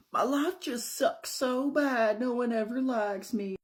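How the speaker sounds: high, slow Southern wail; Southern accent. Acted. Evie competing in misery with Brandy, high Southern whine. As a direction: whine it in a hammed-up Texan drawl. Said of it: "really strong, uh, Southern accent".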